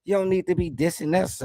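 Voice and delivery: usual speaking voice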